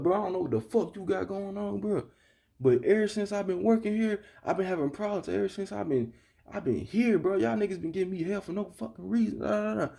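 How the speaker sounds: high-pitched aggrieved rant; acting out a fed-up outburst at the boss; retold going off. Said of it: "I went off on that man".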